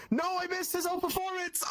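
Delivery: high-pitched wail of playful dismay; hammed-up high outcry; mock dismay. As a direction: act out panicked disappointment, voice shooting way up. Acts out own dismayed reaction.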